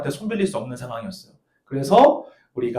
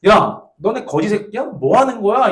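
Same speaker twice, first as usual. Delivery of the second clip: retold scolding boss; 5 semitones higher, 4 dB louder than usual. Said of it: "이러면서 막 혼내더라고".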